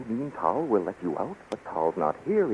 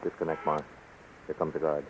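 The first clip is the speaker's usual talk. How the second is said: low, quiet urgent warning; hushed urgent; 6 semitones lower, 7 dB quieter than usual. Acted. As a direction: keep it down, the guard is near. Hiding the phone from guard.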